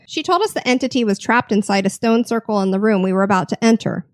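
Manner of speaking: normal talking voice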